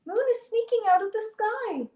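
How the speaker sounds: high, urgent cry; excited high-pitched alert; yelled warning. A narrator's reading. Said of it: "all the animals yell to Old Woman".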